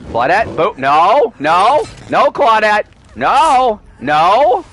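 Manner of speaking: loud, rising string of nos; exasperated no. Real, not acted. Streamer pleading with the fleeing survivor, loud and rising. Claudette ignores the request.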